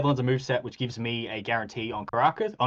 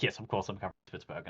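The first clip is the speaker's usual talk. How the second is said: quick, much softer, offhand; dismissive reassurance; 5 semitones lower, 10 dB quieter, much faster than usual. Retold or imitated. Russia brushing off the question, fast and offhand. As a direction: toss it off as obvious, fast and low-key. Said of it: "They're like".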